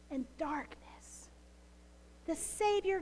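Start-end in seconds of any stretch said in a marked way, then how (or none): 0.1-1.3 breathy, trailing whisper; hushed, grave whisper of darkness. Real, not acted. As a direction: let the word fade into a whisper. Whispered sermon line.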